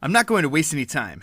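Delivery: usual speaking voice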